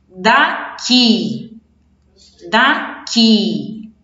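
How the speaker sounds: daqui split slowly, long strong QUI; slow teaching demo of daqui, QUI drawn out; stress on last syllable. Shown as a demonstration. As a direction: lean hard on the QUI in daqui. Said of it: "o que é a última sílaba".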